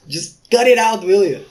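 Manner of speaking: high, loud exasperated scolding. Acted. Sister scolding her brother, high exasperated yell.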